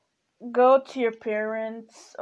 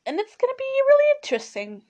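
high, fast and eager; excited; 14 semitones higher, 3 dB louder, faster than usual. Real, not acted. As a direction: pitch it up with excitement. Teasing the next episode.

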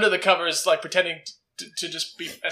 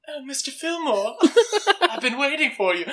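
camp voice; 8 semitones higher than usual. Acted. Jack, hiding under the covers, greets Mister Fillmore, camp voice. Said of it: "like, a camp voice".

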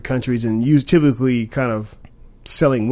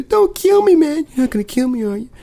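high, desperate pleading voice; begging for my life; 3 dB louder than usual. Retold or imitated. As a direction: beg them not to shoot, voice pitched up. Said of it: "them begging for their lives".

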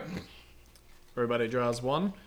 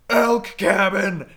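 booming drawn-out shout; really big voice; 6 semitones higher, 10 dB louder, much slower than usual. Retold or imitated. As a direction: bellow it like a counselor rallying his cabin. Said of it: "with his really big voice".

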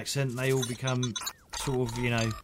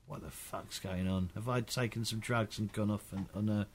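bewildered mumble; 6 semitones lower, 9 dB quieter than usual. Retold or imitated. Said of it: "there's me, me and Kel going".

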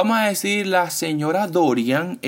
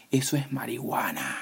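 hushed, sinister whisper; a creepy, malevolent whispered line; voice from beyond the grave; 4 semitones lower, 8 dB quieter than usual. Retold or imitated. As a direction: whisper it like something evil from a tomb. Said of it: "con una voz como de ultratumba, como malévola".

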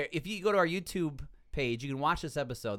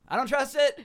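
much higher and louder "I don't trust it."; emphatic distrust; 11 semitones higher, 7 dB louder than usual. Real, not acted. Doubting facial recognition to the co-hosts, emphatic and loud. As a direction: punch it out in protest.

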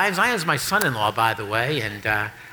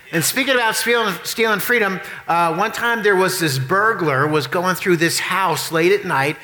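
normal talking voice

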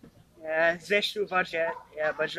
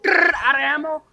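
higher, louder rattle of a gun then a quick quip; tommy gun imitation; 8 semitones higher, 5 dB louder than usual. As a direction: spit out a rolling gun rattle. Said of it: "one's like a Tommy gun".

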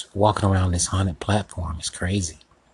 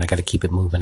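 usual speaking voice

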